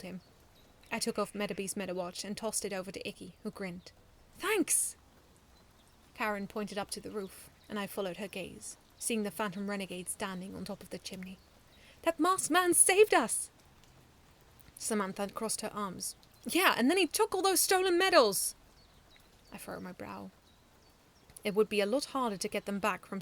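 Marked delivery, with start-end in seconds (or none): 4.4-5.0 cheerful thanks. A narrator's reading. Grinning child thanks. 12.0-13.5 high, louder, slow amazement; awed child. A narrator's reading. Child in awe. 16.4-18.6 high, loud grumbling protest; indignant girl. A narrator's reading. Samantha arms crossed, protesting.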